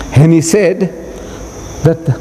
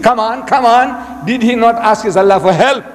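higher-pitched, insistent goading; impatient demand; 6 semitones higher than usual. Retold or imitated. Reverend stamping his feet at the silent Maulana, demanding. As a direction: press hard for the answer. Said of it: "he started stamping his feet".

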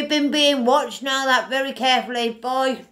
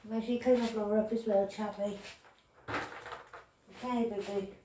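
hushed and quick, cooing to the cat; quiet pet talk, much softer; 3 semitones lower, 13 dB quieter than usual. Real, not acted. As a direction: murmur to your pet while busy. Talking softly to her cat.

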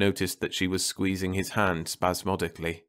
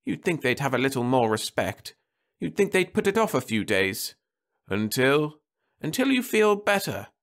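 wife's voice lifted higher and gentle; anxious wife at the sickbed; Martha's voice; 7 semitones higher than usual. A narrator's reading. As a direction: voice the worried wife, pitched up.

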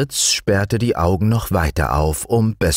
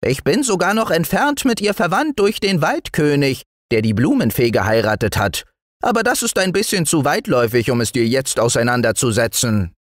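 high, quick self-important gnome; piping little show-off; proud little dwarf; 10 semitones higher, faster than usual. Acted. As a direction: rattle off the family links grandly. Dwarf figure boasting of relations.

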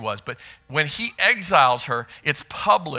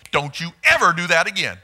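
EVER leaned on hard, stern and falling; mock stern rule with "ever" stressed; 4 dB louder than usual. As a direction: bark it like a father laying down the law, leaning on "ever". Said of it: "Always the emphasis on ever".

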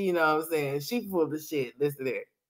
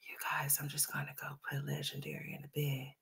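breathy whispered mockery of a vlogger; mocking whisper; 8 semitones lower, 16 dB quieter than usual. Reaction streamer mocking a vlogger's whisper, whispered. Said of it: "talking about some".

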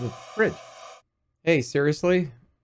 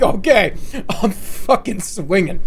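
mock tough; 6 semitones higher, 6 dB louder, slower than usual. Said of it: "I'm like".

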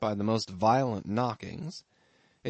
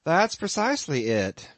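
a lightly voiced innkeeper, higher and a bit louder; amused landlord; 6 semitones higher, 5 dB louder than usual. Innkeeper teasing his lodger about the harpooneer, lightly voiced. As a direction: spin the tale with a sly chuckle underneath.

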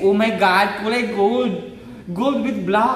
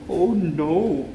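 ordinary talk, nothing marked

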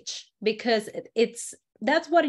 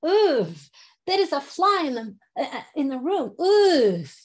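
higher, loud oof of disgust; disgusted, following fly; 3 semitones higher, 4 dB louder than usual. Shown as a demonstration. Teacher acting out disgust at a fly in the room. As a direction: squirm as the fly passes. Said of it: "just follow the fly".

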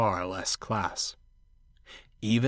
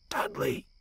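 hushed whispered call; 7 dB quieter than usual. A narrator's reading. Bear tries to wake Dudley at night, whispering. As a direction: whisper the name to a sleeper. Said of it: "whispered Bear".

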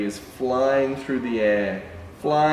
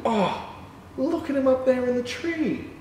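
much higher, thrilled voice; delighted amazement; 13 semitones higher than usual. Pretend. Showing children the dog in the tree, delighted. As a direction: squeal with delight for the kids.

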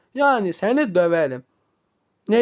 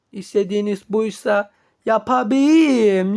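ordinary delivery

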